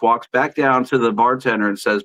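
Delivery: ordinary talk, nothing marked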